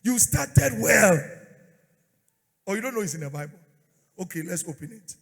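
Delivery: loud preaching at full force; fiery preacher sermon. Acted. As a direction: preach it hard to the crowd.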